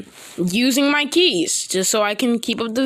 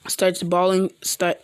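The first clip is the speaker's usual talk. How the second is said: ordinary delivery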